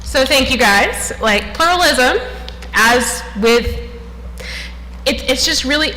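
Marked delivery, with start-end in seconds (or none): 2.7-5.9 breathless after rushing; catching breath between words. Real, not acted. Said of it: "sorry, I'm catching my breath".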